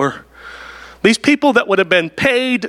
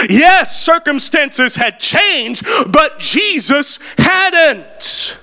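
preaching at full force; 6 semitones higher, much slower than usual. Acted. Preacher crying out Jesus's words to the congregation, impassioned.

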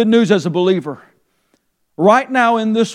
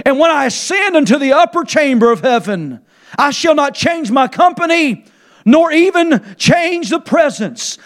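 rising preaching cry; 7 semitones higher than usual. Acted. Preacher reading the writer's words to the church, crying out. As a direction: lift it up and cry it out.

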